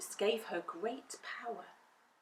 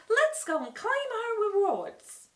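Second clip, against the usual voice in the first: put-on high, loud eager girl; rally the friends brightly; Dorothy rallying her friends; girl's eager voice; 14 semitones higher, 8 dB louder than usual. A narrator's reading.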